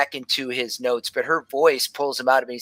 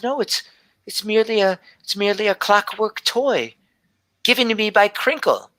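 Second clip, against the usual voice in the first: lightly voiced young girl, slightly higher; a hint of a shy seven-year-old telling her dream; little girl Gelby; 7 semitones higher than usual. Gelby answers Nigel.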